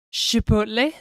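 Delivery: put-on French, rising at the end; French accent. Shown as a demonstration. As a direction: say it as if it were French. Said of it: "We can say it with a French accent".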